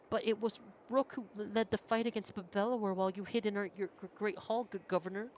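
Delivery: high, stammering nervous voice; nervous stutterer. Acted. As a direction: stumble over every name, high.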